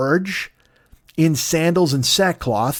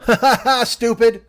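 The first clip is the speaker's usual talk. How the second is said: high taunting ha ha; mocking laugh; 7 semitones higher, 4 dB louder than usual. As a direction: jeer at them like a bratty kid. [name] mocks family.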